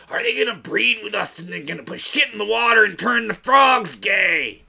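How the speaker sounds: paranoid shout, high and loud; mock-panicked outburst; hammed-up fearful rant. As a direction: build into a conspiracy-nut yell. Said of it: "See, here's the fear".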